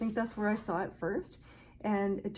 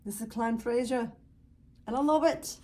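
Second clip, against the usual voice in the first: put-on a half-hearted stab at a Scottish accent, rising higher and louder; jokey bad Scottish accent, higher and louder at the end; 3 semitones higher, 5 dB louder than usual. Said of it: "Sorry, I won't do bad Scottish accent".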